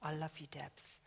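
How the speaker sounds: soft unvoiced whisper of tenderness; God whispering his love to Debs. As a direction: breathe it like a secret. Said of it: "Father God is always saying to me".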